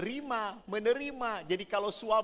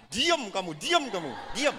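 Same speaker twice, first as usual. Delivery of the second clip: mock angry wife; 4 semitones higher than usual. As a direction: snap at the snoring husband, hammed-up. Wife kicking snoring husband. (istri membentak suami yang mendengkur)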